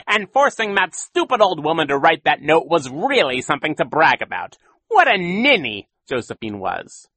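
high, loud, gloating villain; boastful cackling-mood villain; Count Olaf voice. A narrator's reading.

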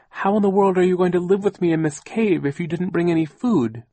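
high, loud, indignant aunt; Aunt Josephine. A narrator's reading. Aunt dismayed that children brought no food, indignant.

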